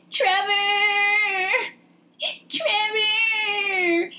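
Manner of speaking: very high, long-held mock scream. Stupid Stacy seeing Helen come through the door, screaming. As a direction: shriek his name in a drawn-out wail. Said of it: "she just starts screaming".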